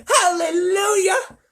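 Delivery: very high, loud, drawn-out cry; yelled. Acted. Grandpa Joe rejoicing, very high slow yell. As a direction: cry it to the heavens. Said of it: "yelled Grandpa Joe".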